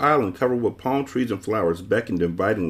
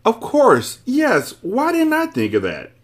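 very high, loud burst of realisation; excited shout; 6 dB louder, slower than usual. A narrator's reading. Man suddenly learns who he is, excited shouting. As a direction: shout it out as it dawns on you. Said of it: "the man shouted".

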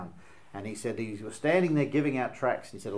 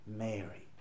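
tender call; 4 semitones lower, 13 dB quieter than usual. Acted. Christ calls Mary by name.

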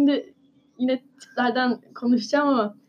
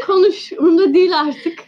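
exasperated outburst; 5 semitones higher, 8 dB louder than usual. Real, not acted. Fed up with Snape.

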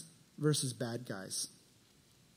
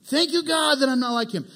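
smug; 7 semitones higher, 4 dB louder than usual. Retold or imitated. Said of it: "He extortions people".

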